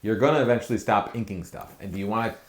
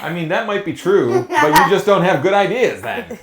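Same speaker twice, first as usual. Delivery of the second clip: high, loud ribbing through laughter; laughing tease; 8 semitones higher, 8 dB louder than usual. Real, not acted. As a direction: rib your friend while cracking up. Teasing friend, laughing.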